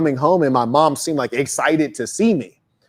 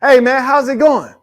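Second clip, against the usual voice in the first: cheery greeting; 9 semitones higher, 5 dB louder than usual. Acted. Acted street greeting.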